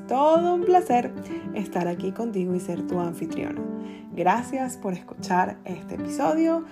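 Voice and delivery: upbeat host intro over music; podcast intro. Acted. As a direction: read it like a radio opener.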